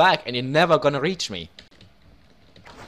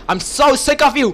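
louder and higher, fed up; angry; 6 semitones higher, 4 dB louder than usual. Real, not acted. Gamer fed up with an annoying enemy player, angry. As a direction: snap it at him, finally done.

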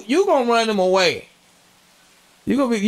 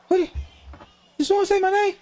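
spooked high voice; 13 semitones higher, faster than usual. Real, not acted.

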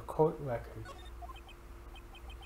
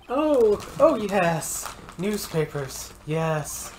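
high, sly weasel voice; oily, eager weasel of a man; weaselly voice; 5 semitones higher, 7 dB louder, much slower than usual. Acted. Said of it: "Time to do my most weaselly voice".

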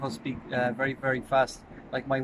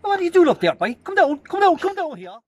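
high, loud excitable Irish accent; other fellas' Irish accent; 9 dB louder than usual. Shown as a demonstration. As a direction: call it out high and loud like rural Irish lads. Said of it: "then you have the other fellas".

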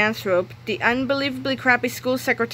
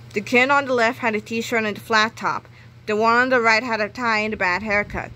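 ordinary delivery

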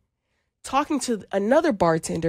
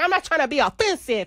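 higher, put-on Spanish accent; mock Spanish accent, pitched up; attempted Spanish accent; 7 semitones higher than usual. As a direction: imitate a man faking a Spanish accent. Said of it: "trying to get the accent".

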